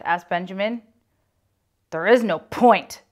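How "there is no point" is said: grumbling. Acted. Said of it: "Myron grumbled".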